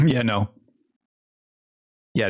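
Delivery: normal talking voice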